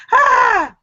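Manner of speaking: high, loud mock shriek of shock; re-enacted scream. Telling of the raccoon's big face, mock scream. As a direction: act out a startled shriek.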